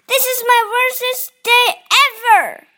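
high, loud, fast angry outburst; temper tantrum. A narrator's reading. Franklin at the closed pond, angry outburst. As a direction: throw a childish tantrum. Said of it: "Franklin lost his temper".

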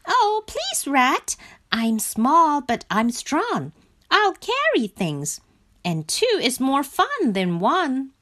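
high little frog voice, pleading; high-pitched, earnest coaxing. A narrator's reading. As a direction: beg eagerly in a high character voice. Frog pleads to come along.

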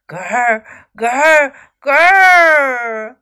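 growls building to a loud long one. A narrator's reading. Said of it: "The growling got louder and louder".

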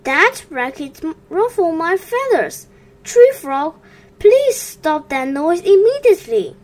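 a fussy bird telling off the noisy frog; irritated feathered neighbour; annoyed pond bird. A narrator's reading. Annoyed bird scolds Tree Frog.

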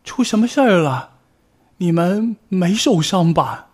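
put-on high, anxious call of an old bear; worried granny calling through the wall. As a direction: fret about the cubs as you call. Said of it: "担心地呼喊着".

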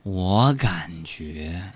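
sloth. Pretend. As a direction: stretch every word like a sloth. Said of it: "用树懒的方式来说话".

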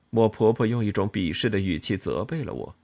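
ordinary delivery, nothing marked

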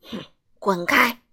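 a grumpy man barking go away; rude snap. A narrator's reading. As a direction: brush the elf off rudely. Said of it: "傲慢先生粗鲁地说".

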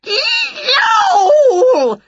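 long, loud high yowl; piercing drawn-out yelp of pain; pained cat yell. A narrator's reading. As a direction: yowl like a cat whose tail hurts. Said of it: "yelled Wilbur".

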